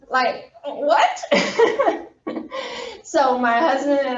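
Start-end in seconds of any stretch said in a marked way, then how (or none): 0.1-1.2 mock confused, laughing high; baffled laugh. Mock baffled reaction to quote. 1.3-3.1 bright burst of real laughter; high laugh. Real, not acted. Laughs after baffled reaction.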